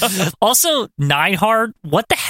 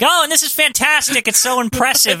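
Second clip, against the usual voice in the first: mimicking Dick Graham; 7 semitones higher than usual. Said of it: "Dick Graham's like".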